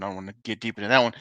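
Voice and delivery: usual speaking voice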